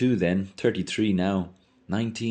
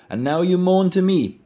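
high, loud scornful jab; scolding outburst; 13 semitones higher, 7 dB louder than usual. Acted. Mulligan rebukes Stephen for moaning, loud and high. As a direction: throw it at him, much higher and louder.

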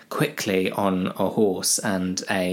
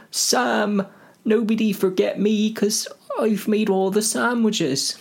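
squeaky hobbits; 10 semitones higher than usual. Acted. Sam reminding Gandalf he made the sandwiches, high eager voice. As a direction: chirp like a keen little hobbit.

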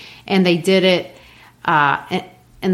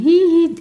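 innocent giggle; 11 semitones higher, 4 dB louder than usual. Retold or imitated. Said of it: "who just grins at him".

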